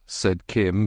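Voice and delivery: usual speaking voice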